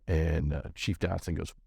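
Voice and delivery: normal talking voice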